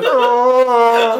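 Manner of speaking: high, hammed-up bellowing groan; mock cow moan. Shown as a demonstration. Telling a friend, imitating a labouring cow's groan. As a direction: moan like a cow giving birth. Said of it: "I'm like a cow giving birth".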